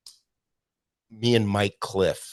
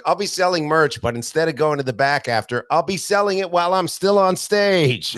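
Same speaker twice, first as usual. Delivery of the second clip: much higher and louder mock announcer pitch; douchey self-imitation; 10 semitones higher, 7 dB louder than usual. Comic mocking his old douchey merch announcement, high and loud. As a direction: ham up a smug stage pitch. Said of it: "this douchey thing".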